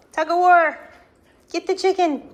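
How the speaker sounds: high, loud cheer; excited cheering. Real, not acted. Owner cheering puppies playing tug of war. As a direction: egg them on in the tug.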